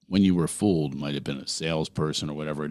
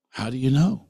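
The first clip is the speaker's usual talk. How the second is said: incredulous; 7 semitones higher, 5 dB louder than usual. Retold or imitated. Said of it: "I'm like".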